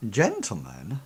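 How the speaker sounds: soft, low questioning call; Athena. Acted. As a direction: waft it gently over the airwaves. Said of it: "Athena's voice sort of, like, waft over the airways".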